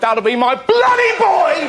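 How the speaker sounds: high exasperated dad yell; long-suffering dad. Acted. Dad in the showers hearing his son, exasperated shout. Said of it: "His long-suffering dad is in the showers".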